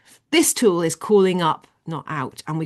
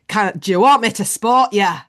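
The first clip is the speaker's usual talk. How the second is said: louder, hammed-up Scottish gym guy; Scottish accent; 6 dB louder than usual. Big Scottish guy offering to spot James, Scottish accent. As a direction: call it out in an amateur Scottish accent. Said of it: "I don't do accents as well as some people".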